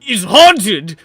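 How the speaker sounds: loud, high panicked rant; hammed-up terror at a haunted orphanage; panicked cultist. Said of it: "the other one says".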